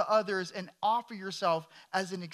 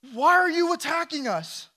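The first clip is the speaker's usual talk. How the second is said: loud, high-pitched protest; raised-voice demand; indignant challenge; 8 semitones higher, 6 dB louder than usual. Acted. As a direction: call it out in alarmed protest at the attackers. Acted challenge to the attackers.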